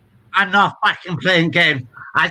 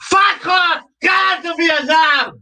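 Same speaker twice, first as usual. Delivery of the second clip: swearing tirade; 8 semitones higher than usual. Real, not acted. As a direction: yell the abuse at full force. Shouting at a man outside.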